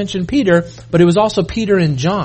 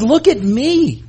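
Jesus speaking; 6 semitones higher, slower than usual. Acted. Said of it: "Jesus says".

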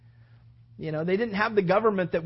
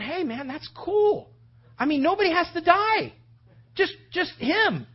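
put-on eager young David; 8 semitones higher, 4 dB louder than usual. Young shepherd welcomes giant's challenge to his own side, eagerly. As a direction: act delighted, as if handed a bargain.

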